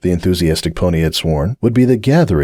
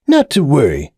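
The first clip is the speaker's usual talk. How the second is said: mock sage; 6 semitones higher than usual. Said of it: "mocking a sagely tone".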